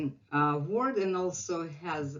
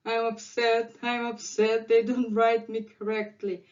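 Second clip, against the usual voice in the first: higher-pitched mock complaint; upset letter yo; 4 semitones higher than usual. Offended letter yo complaining about missing dots, mock upset. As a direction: play a letter with hurt feelings. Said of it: "yo is always kind of".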